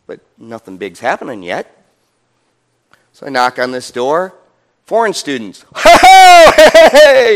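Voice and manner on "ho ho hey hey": very high, loud cheerful hollering; excited greeting. Retold or imitated. Students welcoming the preacher back, high and loud. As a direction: shout a delighted welcome.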